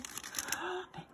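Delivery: soft, quick intake of breath; hushed gasp of surprise; surprised gasp. Real, not acted. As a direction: gasp softly at a new find. Surprised by next item.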